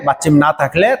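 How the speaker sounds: ordinary talk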